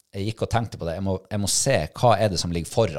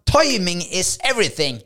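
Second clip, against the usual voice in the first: high, louder English catchphrase, proclaimed; triumphant exclamation; 10 semitones higher, 4 dB louder than usual. Retold or imitated. Recounting the moment the dog obeyed, triumphant high proclamation. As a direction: announce it like a hard-won revelation. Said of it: "jeg tenkte bare".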